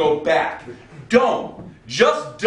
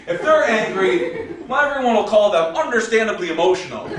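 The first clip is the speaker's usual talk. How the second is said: raised, measured spoken-word delivery; sarcastic poem recital; 6 semitones higher than usual. Acted. Poet reciting to the crowd, sarcastic spoken word. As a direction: perform the poem with biting mock reason.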